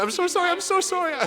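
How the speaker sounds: high-pitched, frantic imitation of apologizing; mock profuse apology. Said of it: "the most apologetic boy I've ever seen".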